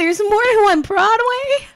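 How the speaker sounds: as if she can't believe there's another Broadway; disbelieving yelp about the two Broadways; shocked disbelief. Retold or imitated. Finding out there are two Broadways.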